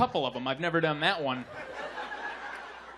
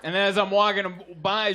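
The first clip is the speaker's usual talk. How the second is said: ordinary talk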